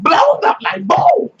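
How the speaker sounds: loud, high boom; explosion sound. Retold or imitated. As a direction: shout the boom like a blast. Acting out an explosion.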